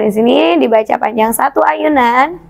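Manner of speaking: ordinary talk, nothing marked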